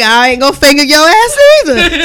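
loud, high, laughing comeback; loud mock rant. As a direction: yell it back with a laugh. Ranting at the guy, laughing.

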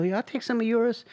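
high, soft imitation of the friend; lightly voiced sheepish reply; mock friend voice. Friend accepting shared food, high soft mock voice.

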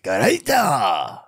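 slow drawn-out mock Japanese; playful fake Japanese; fake Japanese word. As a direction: stretch out the made-up word. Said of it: "We'd be like".

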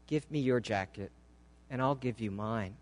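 low, soft, assured command; hushed but firm; quiet authority. A narrator's reading. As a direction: say it quietly, expecting to be obeyed. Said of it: "Such quiet authority in his voice".

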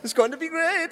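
high, excitable mock German accent; German Willy Wonka. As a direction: gush as the German waiter. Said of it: "like, a German Willy Wonka".

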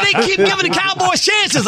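high mock wife complaining; whiny wife, lightly played; imitating wife. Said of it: "She said".

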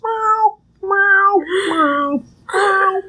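very high, drawn-out meow; cat meow. Retold or imitated. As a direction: wail it like a wandering cat.

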